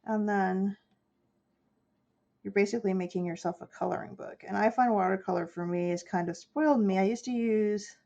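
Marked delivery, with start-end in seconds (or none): none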